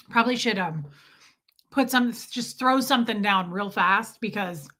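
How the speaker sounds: ordinary talk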